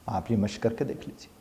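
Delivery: usual speaking voice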